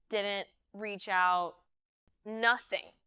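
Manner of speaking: plain reading voice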